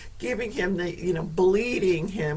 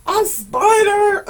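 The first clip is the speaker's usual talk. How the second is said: high, slow, loud shriek; dragged-out shriek about the spider; mock shriek; 14 semitones higher, 10 dB louder, slower than usual. Real, not acted. Recalling the spider.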